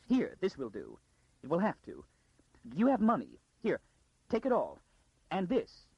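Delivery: a high, urgent frightened noblewoman; frightened lady. Acted. Frightened Lady Kalia hurrying Arcadia out, high and urgent. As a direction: press the girl to take everything and go.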